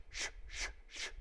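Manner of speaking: soft voiceless sh sounds; demonstrating the sh sound; hushing sh. Shown as a demonstration. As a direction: hiss sh, sh. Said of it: "Notice the sh".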